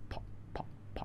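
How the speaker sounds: ফ as a pure puff of breath; whispered ফ. Shown as a demonstration. Teacher whispering the aspirated sound for students. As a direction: whisper ফ, all air, no voice.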